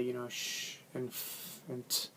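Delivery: soft slow sh, f, t sounds said on their own; quiet isolated consonants; sound demo. Shown as a demonstration. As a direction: breathe out sh and f, tap the t. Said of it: "learning how to say".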